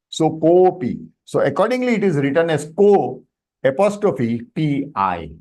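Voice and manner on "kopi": kopi with a lengthened "ko"; extended vowel. Shown as a demonstration. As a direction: linger on the o of kopi. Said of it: "You have extended a bit".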